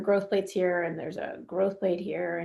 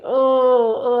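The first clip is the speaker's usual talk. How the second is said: loud mock groan getting up; a tween getting up lets out a loud mock groan; mock moan; 2 semitones higher, 7 dB louder than usual.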